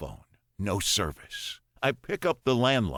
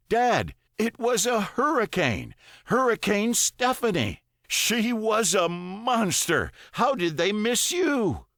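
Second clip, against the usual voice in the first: urgent son; 12 semitones higher, 3 dB louder than usual. A narrator's reading. Son tells of hurricane.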